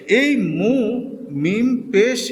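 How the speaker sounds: মু said alone, then মিম পেশ drawn out slowly; demonstrating a sound. Shown as a demonstration. Teacher showing students how মু is read. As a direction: hold মিম পেশ long and slow, the sound shown clearly. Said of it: "আমি এখানে দেখাচ্ছি আপনাদেরকে".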